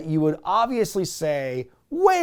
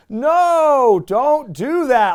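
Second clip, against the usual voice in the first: crowd protest; 10 semitones higher, 8 dB louder, much slower than usual. Retold or imitated. Crowd pleading with Triple H.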